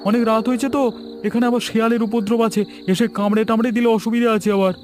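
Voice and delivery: high and hasty, hiding something; high-pitched stranger urging speed; stranger's high, hurried voice. Acted. Stranger hurries Sabur away.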